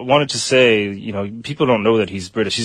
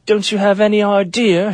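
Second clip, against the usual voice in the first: imitated British rant, higher pitched; imitating British outburst; 9 semitones higher, 3 dB louder than usual. Imitating an actor screaming at crew on set, mock British outburst. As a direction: mimic an indignant British actor mid-tirade. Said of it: "he's screaming at somebody".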